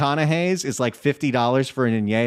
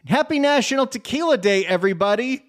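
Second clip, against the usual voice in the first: much higher, bright festive toast to listeners; excited; 9 semitones higher than usual. Real, not acted. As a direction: cheer it out like raising a glass. Host's festive sign-off.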